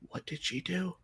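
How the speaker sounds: soft, high little whisper; whispered question. Acted. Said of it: "she hears a whisper behind her".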